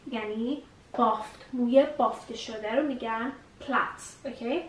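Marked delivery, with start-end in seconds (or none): none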